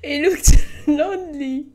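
reading through helpless laughter, voice high; breathless giggling through the lines; laughing while reading. Real, not acted. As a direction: try to read but keep cracking up. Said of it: "I'm sorry".

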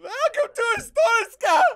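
put-on very high, loud accented cry; over-the-top border-guard holler; hammy shouted welcome. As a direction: shout a mock-grand welcome to the country. Calls out greeting as inspector.

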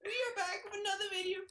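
squeaky high YouTuber intro voice; put-on squeaky intro voice for the video; high hyped intro. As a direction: pitch way up and bounce through the greeting.

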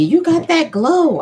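high, slow mock admirer voice. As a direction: lifts the voice to play the admirers.

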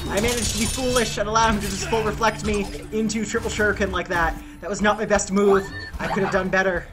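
normal talking voice

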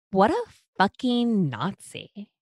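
put-on stuck-up mean girl, slow and sneering. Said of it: "in her stuck-up mean girl voice".

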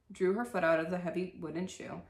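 plain reading voice

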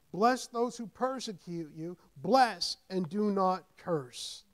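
loud emphatic reading, slowing at the end; preacher reading Romans to the congregation; scripture proclamation. Acted. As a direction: lay out each line of the verse firmly.

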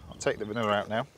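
normal talking voice

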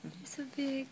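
soft awed whisper. Real, not acted. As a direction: hush it tenderly. Whispering over sleeping baby.

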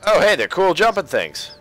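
usual speaking voice

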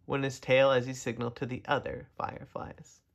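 ordinary delivery, nothing marked

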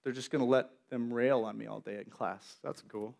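low, soft hurt sarcasm; quietly wounded; hurt God. Retold or imitated. God hurt by his silent friends, low and soft. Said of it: "God's like".

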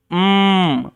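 "উম" as a long closed-lip mmm through the nose; nasal M sound. Shown as a demonstration. Teacher showing learners the M sound, a held nasal hum. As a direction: hum "উম" with no vowel in it. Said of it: "নাক দিয়ে পুরো সাউন্ডটা বেরোবে".

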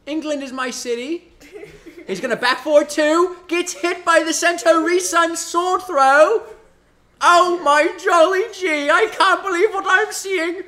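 loud, high hammed-up British commentator; British accent. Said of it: "They'll put in a British accent".

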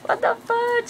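very high, slow, stretched-out gushing; telling friend how she reacted; retold excitement. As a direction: gush like you can't believe it. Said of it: "Y yo".

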